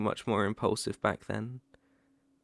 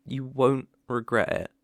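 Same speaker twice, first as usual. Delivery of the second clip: ordinary talk, nothing marked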